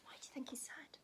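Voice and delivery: soft whispered question; hushed gentle question. Pretend. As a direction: lean in and whisper to the kids. Asks kids in hush.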